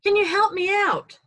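high, loud shout for help. Acted. Man in a hole yelling up to a doctor, loud and high. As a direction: yell up from the bottom of a hole. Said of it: "the guy shouts up".

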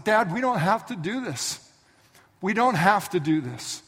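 high, slow tearful plea; pleading boy. Retold or imitated. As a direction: beg through tears. Crying Isaac pleading.

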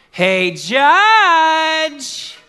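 loud, high, drawn-out holler; widow hollering for justice. Acted. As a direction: call out brashly, stretching the last word. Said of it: "That's how she would say it".

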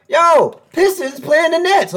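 hyped caller. Retold or imitated. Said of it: "It's like".

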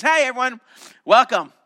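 loud, cheery greeting to a big field; calling out to crowd. Acted. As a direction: call it out to thousands, bright and big.

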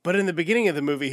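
usual speaking voice

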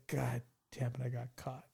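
put-on a caught kid muttering in shame; muttered to himself. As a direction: mutter it, defeated. Inner groan at being caught.

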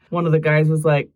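normal talking voice

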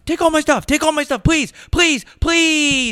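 shrill high begging, loud; screaming mock terror at burglars; mock panic. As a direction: beg the robbers in a hammed-up falsetto. Acted robbery panic.

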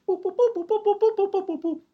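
high mock beeping of a phone dial; sung-out dial tones, very high; phone dialing beeps. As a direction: beep out the number like a keypad.

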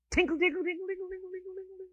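high tinkling, fading away; singsong tinkle trailing off very quietly; imitated tinkling debris. Imitating crash debris in a story.

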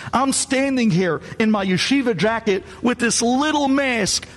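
rising to a high, exasperated demand; exasperated argument. Retold or imitated. Rabbi to the grave digger, demanding the shovel in high exasperation.

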